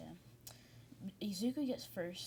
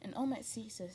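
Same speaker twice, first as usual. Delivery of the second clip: usual speaking voice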